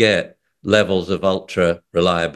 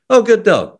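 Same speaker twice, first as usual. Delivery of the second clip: much higher, louder gushing praise; high excited praise; 13 semitones higher, 6 dB louder than usual. Retold or imitated. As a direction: gush over the good dog. Praising dog in retold training.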